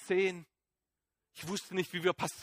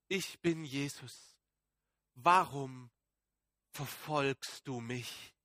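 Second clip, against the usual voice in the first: slow, soft and lower, each word weighed; solemn hushed voice; 6 semitones lower, 7 dB quieter, slower than usual. A narrator's reading. Jesus speaking to Saul on the road, slow and hushed. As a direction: let the heavenly voice speak gently and gravely. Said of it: "sprach sie noch einen Satz".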